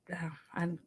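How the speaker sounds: tripping over words, half whispered; mumbling. Real, not acted. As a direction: mumble and lose the thread. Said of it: "mumbling my words now".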